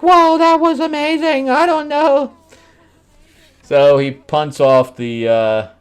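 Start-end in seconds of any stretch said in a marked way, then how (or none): 0.0-2.3 very high, loud, awed cartoon voice; mock amazement. Acting out his awe once the moon stops, mock amazement. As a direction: gush it out at a put-on high pitch.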